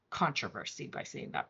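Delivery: a conspiratorial whisper to the class; whispered aside. Real, not acted. Teacher whispers a cheeky aside to students. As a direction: whisper the word controversy, unvoiced. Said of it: "I could start".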